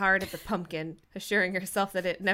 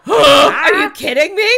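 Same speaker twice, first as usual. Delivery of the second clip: loud, indignant disbelief; furious and shocked; surprised anger; 7 semitones higher, 18 dB louder than usual. Acted. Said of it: "surprise mixed with anger".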